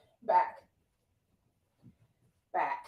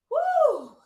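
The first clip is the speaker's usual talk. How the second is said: high, loud whoop of energy; excited woo; 13 semitones higher, 6 dB louder than usual. Real, not acted. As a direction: let out a big cheering woo. Coach cheering mid-workout.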